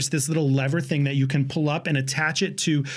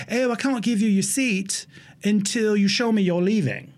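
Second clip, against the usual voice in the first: put-on New Zealand accent for a ticket agent; 3 semitones higher than usual. Ticket agent refusing a standby traveler a seat, New Zealand accent. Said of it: "I get up to the ticket counter".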